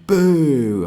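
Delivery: loud, high falling boo; drawn-out boo. Shown as a demonstration. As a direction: boo like an angry crowd. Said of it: "Like, going".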